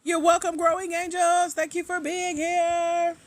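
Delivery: high, loud, stretched-out call; singsong thanks. Real, not acted. Host thanking a live viewer for being here, high singsong call.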